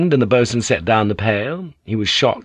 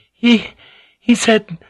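halting, much higher, shaken; stumbling words; 13 semitones higher, 5 dB louder, slower than usual. Acted. Mariko, horrified, trying to tell the samurai, stumbling and much higher. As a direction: stammer it out in shock. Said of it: "Her words stumbled".

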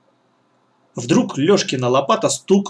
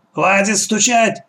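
slow, higher-pitched reprimand; barked order; 7 semitones higher, slower than usual. A narrator's reading. Old woman stops the boy knocking, barking. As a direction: bark it with drawn-out impatience. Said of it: "рявкнула старуха".

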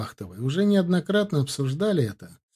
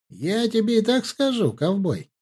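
high-pitched, cocky comeback; cheeky; 8 semitones higher than usual. A narrator's reading. Bazhen crunches an apple and teases his friend, cheeky. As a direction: say it with an impudent smirk, pitched up. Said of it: "нахально усмехнулся".

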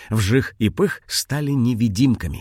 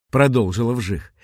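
plain reading voice

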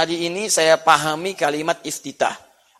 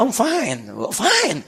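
imitated English accent with swooping pitch; 4 semitones higher, 3 dB quieter, much slower than usual. Shown as a demonstration. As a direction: answer like an English native. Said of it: "Kayak orang Inggris". (logat Inggris tiruan)

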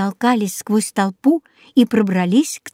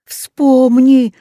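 loud, higher urgent plea; imploring; 4 semitones higher, 7 dB louder than usual. A narrator's reading. Rex begs Jaco to recall the spell, loud imploring. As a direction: beg with full voice. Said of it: "молил его Рекс".

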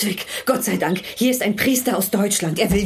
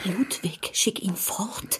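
whisper; 8 dB quieter, much slower than usual. Acted. Wife urges husband privately.

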